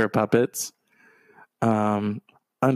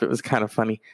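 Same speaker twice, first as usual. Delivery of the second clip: usual speaking voice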